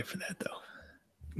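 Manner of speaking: whispered aside; hushed, breathy joke whisper. Real, not acted. Joking aside to cohost.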